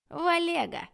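high, loud gleeful blurt; little brother gleefully telling on his sister; gleeful shout. A narrator's reading. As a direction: announce it like a triumphant little brat. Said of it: "радостно известил окружающих Арчи".

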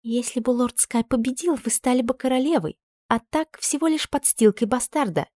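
soft, high, venomous; through gritted teeth. A narrator's reading. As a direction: spit it out quietly with spite. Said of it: "процедила Гарьярда".

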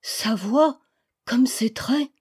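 a touch of ecstatic wonder; hushed amazement, lightly played; rapt murmur. A narrator's reading. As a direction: murmur it as if spellbound. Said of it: "plongé dans une sorte d'extase".

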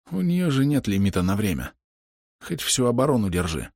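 lightly voiced weakened fighter; a hint of strain from a dying man; wounded archer. A narrator's reading. Dying archer argues the sphere will hold, weakened voice.

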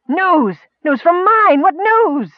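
very high-pitched, urgent outburst; worked-up insistence. A narrator's reading. Miner straining to get his news across, worked up. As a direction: cry it out in exasperation. Exasperated exchange over the news.

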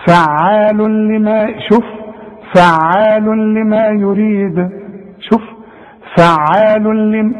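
فعال لما drawn out slowly, the nun of the tanween merged fully into the lam with no nasal hum; slow Quranic demo of فعال لما with full merging and no ghunna; merged recitation. Shown as a demonstration. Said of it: "وننطق مع بعض الكلمة دي".

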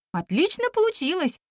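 delighted. A narrator's reading. Goddess admiring her first clay figure, delighted. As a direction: lift the voice with delight. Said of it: "обрадовалась Нюйва".

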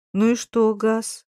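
usual speaking voice

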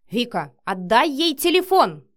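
loud agitated demand; yelling. A narrator's reading. Matvey orders Vika to return the phone, yelling. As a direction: holler the order. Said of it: "вопил Матвей".

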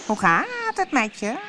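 lightly voiced cat, high and friendly; a sly cat greeting; grinning cat. Cheshire cat greets Alice, lightly voiced and sly.

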